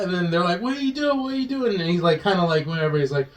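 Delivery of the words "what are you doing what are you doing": high-pitched mock panic; high, retold cry of alarm. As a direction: imitate alarmed onlookers, voice pitched way up. Retold alarm at kid's torture.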